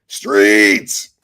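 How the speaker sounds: a loud shout-out; calling out. Real, not acted. Greeting a viewer named Streets with a loud shout-out. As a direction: hail a friend across the room.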